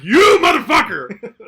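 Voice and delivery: high, drawn-out yell of accusation; mock outraged shout. Podcaster confronting an exposed scammer, outraged shout. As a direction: bellow it like you've caught them red-handed.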